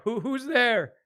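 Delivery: high, loud challenge; stammered shout. Acted. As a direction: shout out to whoever is there. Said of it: "He just shouts out".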